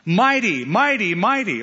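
loud, high ringing chant of "merciful" and "mighty"; angelic cry. Retold or imitated. Preacher voicing angels crying out praise. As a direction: call it out like angels crying to heaven. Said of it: "The angels don't cry".

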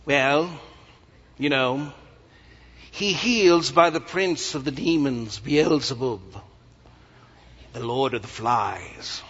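slow, drawn-out mock British accent. Critics accusing Jesus of healing by demons, British accent. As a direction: put on a hammed British accent, savouring each word. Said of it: "sorry if I use this accent, if there are any British folk here".

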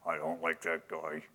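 low grumbling mutter under the breath. Retold or imitated. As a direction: grumble it low and soft to yourself. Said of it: "you mutter stuff under your breath".

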